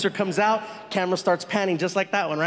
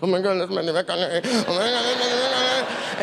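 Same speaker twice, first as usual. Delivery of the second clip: freaking out. Retold or imitated. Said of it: "I start freaking out".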